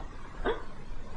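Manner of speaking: soft unvoiced gasp of delight; breathless gasp. Realizing she has butterflies, soft gasp. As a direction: gasp as it dawns on you.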